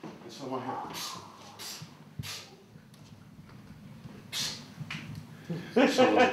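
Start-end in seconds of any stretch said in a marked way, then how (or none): 0.9-4.6 psst hiss. Retold or imitated. Someone hissing from doorway.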